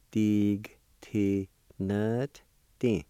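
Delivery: "Dig, T, nut, D" slowly, in separate pieces; syllable by syllable. Shown as a demonstration. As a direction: leave a gap after each syllable. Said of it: "pronounce that T sound with the N V like this".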